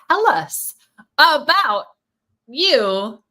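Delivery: big hyped host voice, high and loud; excited. Real, not acted. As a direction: announce it like a talk show host. Host's hyped intro question.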